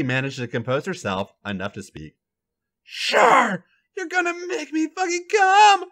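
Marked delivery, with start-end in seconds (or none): none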